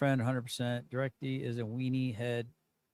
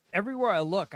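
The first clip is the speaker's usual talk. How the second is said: ordinary talk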